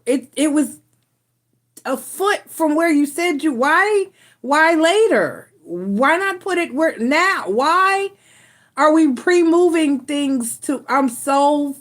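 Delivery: exasperated rant. Real, not acted. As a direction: pitch climbing in disbelief at the pointless move. Venting about misplaced fuse box.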